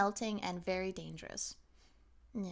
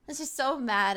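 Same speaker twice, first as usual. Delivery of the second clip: ordinary talk, nothing marked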